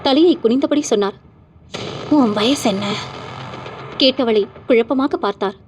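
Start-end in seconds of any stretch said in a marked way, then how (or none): 2.1-2.8 lightly voiced spirit, eerie and calm; a hint of a possessed girl questioning; ghostly woman. Possessed corpse questioning the frightened old man, eerie.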